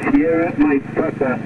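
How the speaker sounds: ordinary talk, nothing marked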